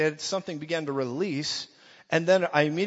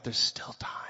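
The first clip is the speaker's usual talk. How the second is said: soft whisper to himself; whispered thought; 5 dB quieter than usual. Retold or imitated. Man watching his hundred dollars go, whispering to himself. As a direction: whisper a last-chance hope. Thought while offering plate leaves.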